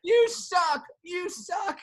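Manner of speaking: high-pitched drunken heckle, acted out; yelling. Drunk fan taunting the relief pitcher, yelling. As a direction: jeer it high from the bleachers. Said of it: "And I'm, like, yelling".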